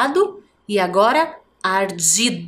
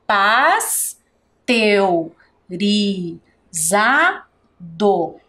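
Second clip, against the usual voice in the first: pasteurizado drawn out very slowly, each syllable apart; much slower than usual. Shown as a demonstration. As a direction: dictate it at a crawl. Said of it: "Esta palavra tem cinco sílabas". (muito devagar, separando as sílabas)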